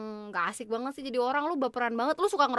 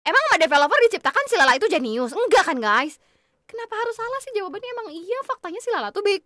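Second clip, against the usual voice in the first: loud, high, fast complaining outburst; indignant rant; 5 semitones higher, 9 dB louder than usual. Real, not acted. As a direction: yell at the game that it's unfair. Protests wrong quiz answer in game. (protes marah keras dan tinggi)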